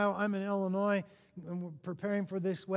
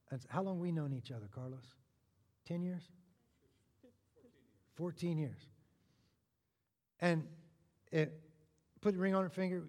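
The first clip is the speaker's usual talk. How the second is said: ordinary talk, nothing marked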